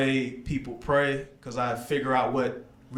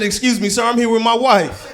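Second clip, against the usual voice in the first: loud, high, scandalized protest; indignant shout; 10 semitones higher, 10 dB louder than usual. Retold or imitated. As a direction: shout it high and outraged at the driver. His outraged reply to driver.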